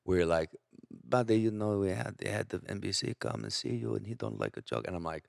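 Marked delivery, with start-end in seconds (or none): 1.0-4.9 lightly put-on club boss voice; a hint of an imitated boss; Jamie impression. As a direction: confide a warning as the boss. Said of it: "he'll say something".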